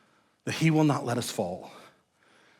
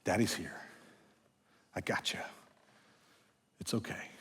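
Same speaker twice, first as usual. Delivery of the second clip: soft hushed lullaby comfort, much softer; soothing a baby; 3 semitones lower, 16 dB quieter than usual. Retold or imitated. Father rocking his crying baby at night, hushed and soothing. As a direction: whisper comfort to a crying child. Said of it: "just rock and just say".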